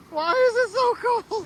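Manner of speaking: high, slow shivery complaint about the cold; cold and grumbling. Real, not acted. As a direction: whine through the chill of the water. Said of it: "it's cold".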